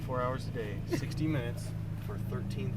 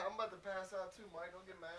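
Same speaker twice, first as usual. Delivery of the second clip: much softer, mock half-asleep mumble; drowsy mumble; 5 semitones higher, 14 dB quieter than usual. Imitating sleepy Mike mumbling to friends, very soft. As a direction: play the dozing friend murmuring an apology. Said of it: "He's like".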